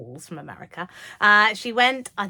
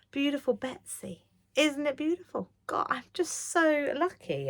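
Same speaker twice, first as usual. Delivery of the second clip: breathless awe; 7 semitones higher than usual. Real, not acted. Gasping over gifted fabric.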